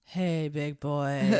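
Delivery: low, slow vocal fry come-on; lightly sultry Kathleen Turner impression; sexy voice. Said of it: "I wanna be like Kathleen Turner".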